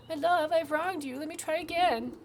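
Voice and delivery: grovel for forgiveness; pleading beekeeper. Acted. Beekeeper begs his angry queen for another chance.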